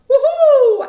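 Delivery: high, loud owl hoot in head voice; head voice demo for children; woohoo head voice. Shown as a demonstration. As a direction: float up into a hooting owl sound. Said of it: "Head voice is my".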